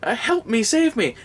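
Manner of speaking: high, slow, stretched-out cry for help; desperate high-pitched plea, overplayed; mock panicked cry. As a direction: wail like a drowning man, hammed-up. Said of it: "and he's like".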